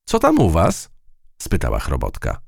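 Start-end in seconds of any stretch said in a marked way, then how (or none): 0.0-0.9 mouse admiring. A narrator's reading. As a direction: marvel at the web. Matylda asks.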